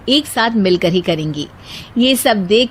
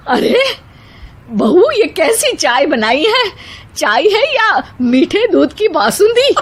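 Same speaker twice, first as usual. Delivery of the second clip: put-on high, exclaiming old woman's scold; scolding mother-in-law; 8 semitones higher than usual. A narrator's reading. Mother-in-law scolds bahu over the tea, high exclaiming voice. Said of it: "कहती हैं".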